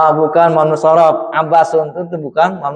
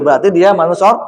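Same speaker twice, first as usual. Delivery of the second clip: ordinary talk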